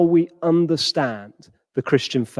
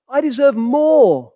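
resentful; 6 semitones higher than usual. Acted. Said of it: "so vexed, so angry".

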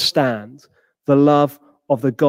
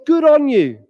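approving cheer; 8 semitones higher, 5 dB louder than usual. Acted. Imagined cheer for protest.

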